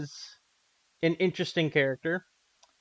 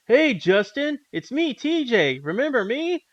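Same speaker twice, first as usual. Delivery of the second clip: TJ voice; 9 semitones higher, 5 dB louder than usual. Retold or imitated. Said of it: "he goes like".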